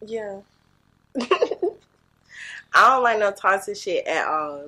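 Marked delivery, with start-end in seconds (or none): 2.8-4.7 loud, firm doubling down; emphatic insistence. Real, not acted. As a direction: insist on it loudly. Doubles down after being doubted.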